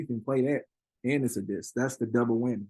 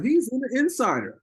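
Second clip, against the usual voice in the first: mock hype; 9 semitones higher, 3 dB louder than usual. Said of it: "It was like".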